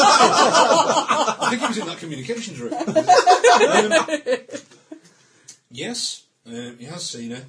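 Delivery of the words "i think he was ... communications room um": high loud laughter through words; laughing hard. Real, not acted. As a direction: crack up while speaking. GM cracking up.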